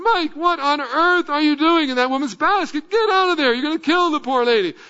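a father yelling at his son to climb out; alarmed yell. Retold or imitated. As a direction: yell at the boy to get out of the basket. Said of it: "I yelled at him".

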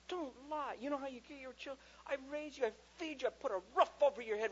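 high, strained parental rant; retold exasperated father; furious dad. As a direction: launch into the worked-up parent lecture. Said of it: "Oh, I was furious".

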